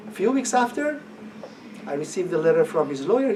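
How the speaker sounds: ordinary delivery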